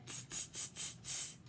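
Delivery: soft unvoiced tss tss of teasing monkeys; soft monkey tutting; monkey tsk. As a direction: tsk at him like cheeky monkeys. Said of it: "shook their fingers at him and said".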